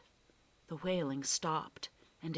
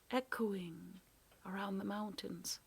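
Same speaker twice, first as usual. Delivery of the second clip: softer and softer to a whisper; fading whisper; 3 semitones higher, 8 dB quieter than usual. A narrator's reading. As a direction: let the echo die away. Echo fading away.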